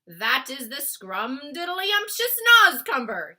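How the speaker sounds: loud, slow, delighted giant; enthusiastic. Acted. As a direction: sell it with relish. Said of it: "he said".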